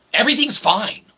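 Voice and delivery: ordinary talk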